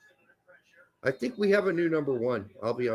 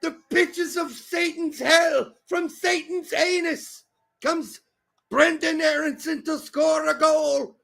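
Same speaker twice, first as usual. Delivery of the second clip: hype shout; 9 semitones higher than usual. Real, not acted. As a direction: hail him like a demon hero. Hyping the goal scorer.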